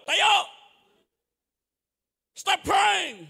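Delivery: high, loud barking command; harsh shout. Retold or imitated. Strict father shouting at his fallen son, loud and high. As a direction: yell at the kid to get up.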